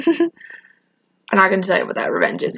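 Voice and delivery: ordinary talk, nothing marked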